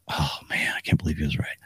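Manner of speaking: hushed whispered realization. Retold or imitated. A friend admitting he was right, in a whisper. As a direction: breathe it out, unvoiced. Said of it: "they're just like".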